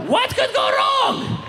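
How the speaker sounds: high, slow sarcastic squeal; mock the obvious danger; ironic. Comic on the backpack plan: high slow sarcastic question.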